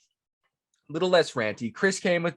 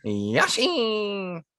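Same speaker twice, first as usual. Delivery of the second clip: high, drawn-out nasally squeak; Yoshi; 6 semitones higher than usual. Shown as a demonstration. Co-host copying the character, nasally high voice. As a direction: pinch it high and hold the ending. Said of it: "It's really nasally".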